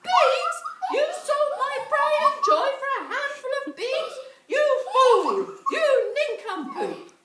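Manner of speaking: high, loud hammed-up rage with a laugh; furious yell. Mother berating Jack for selling the cow, loud and high. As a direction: rant at the fool son, laughing through it. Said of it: "she yelled".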